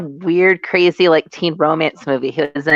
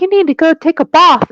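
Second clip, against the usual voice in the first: mock Marion; 12 semitones higher, 4 dB louder than usual. Marion voiced in retold scene.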